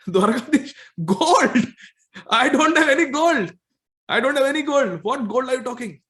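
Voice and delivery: high, loud amused protest; laughing disbelief. Acted. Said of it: "he's, he's laughing. He says".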